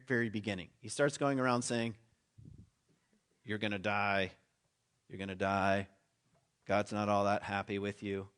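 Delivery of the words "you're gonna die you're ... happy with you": lower, grim warning voice, lightly acted; a hint of a doomsday prophet, intoning it; prophet of doom. Preacher acting Jonah warning Nineveh, lightly grim and low.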